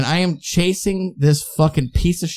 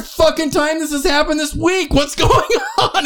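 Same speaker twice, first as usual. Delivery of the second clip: higher, incredulous retold complaint; exasperated disbelief; 11 semitones higher than usual. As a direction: throw up your hands in mock disbelief. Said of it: "I'm like".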